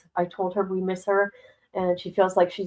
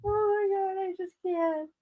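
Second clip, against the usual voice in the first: laughing; 12 semitones higher than usual. Real, not acted. Laughing while watching video.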